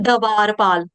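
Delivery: दवारपाल with a vowel between d and v; wrong split cluster. Shown as a demonstration. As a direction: say दवारपाल as da-vaar-paal. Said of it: "उसको हम ये नहीं कहते".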